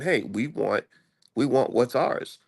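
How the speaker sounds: ordinary delivery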